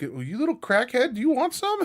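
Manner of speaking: a mock sneaky dealer voice; high needling offer; sly offer. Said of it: "Be like".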